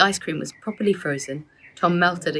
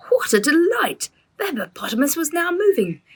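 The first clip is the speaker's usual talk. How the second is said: higher, delighted storytelling burst; excited; 10 semitones higher than usual. A narrator's reading. Father telling the children the hippo story, delighted. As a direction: let the delight lift the voice.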